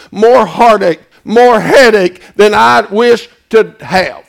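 building, loud, pounding repetition; preaching at full force. Acted. Preacher to congregation, growing into a loud, fervent shout.

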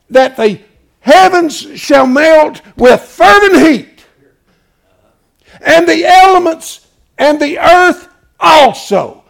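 high, loud and slow, every word weighed; solemn booming scripture reading; thundering scripture. Acted. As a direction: declaim it from the pulpit. Quoting scripture at full force.

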